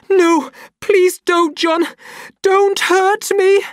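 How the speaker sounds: very high, loud, slow begging; terrified plea. A narrator's reading. Lenina shielding her face, begging John. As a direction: plead for your life.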